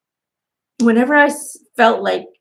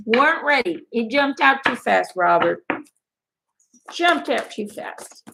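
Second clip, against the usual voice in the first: usual speaking voice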